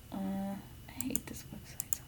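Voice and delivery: annoyed under-the-breath whisper. Real, not acted. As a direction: grumble it under your breath. Muttered frustration at website.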